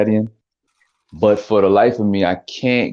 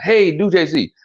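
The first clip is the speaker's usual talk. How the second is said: lightly mimicked higher-pitched fan request; eager request; 8 semitones higher, slower than usual. Someone asking the impressionist for a celebrity voice, lightly mimicked request.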